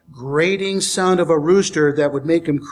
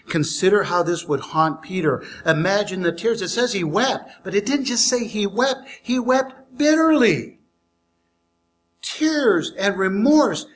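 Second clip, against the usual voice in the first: ordinary talk, nothing marked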